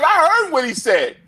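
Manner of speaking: retold scolding boss.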